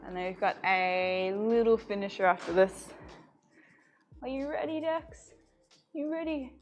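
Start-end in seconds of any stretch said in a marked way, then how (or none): 4.2-6.5 talking to a dog. Real, not acted. Asking the dog.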